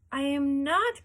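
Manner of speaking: loud, high protest; insist, nearly shouting; boy protesting to his grandfather, loud; cried out. A narrator's reading. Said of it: "cried Charlie".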